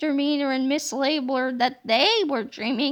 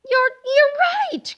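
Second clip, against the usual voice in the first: higher, slow stammering gasp; startled cry; 11 semitones higher, 5 dB louder, slower than usual. Acted. Teacher realizes she must be dreaming, startled and high. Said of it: "cried Miss Anthrop".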